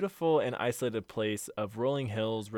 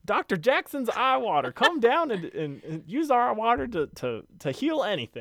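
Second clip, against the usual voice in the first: high, loud southern huckster; old prospector voice; 12 semitones higher, 7 dB louder than usual. Acted. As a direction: hawk it like a frontier salesman. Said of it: "this is where the prospector voice comes in".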